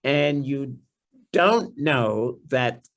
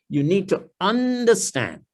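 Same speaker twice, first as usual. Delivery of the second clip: ordinary talk, nothing marked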